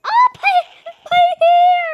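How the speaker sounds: high, loud panicked cry; shocked shriek. Real, not acted. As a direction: shriek about the hair. Hair blown off by rockets.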